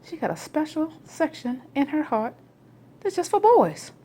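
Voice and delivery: discovering voice. Retold or imitated. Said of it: "And you be like".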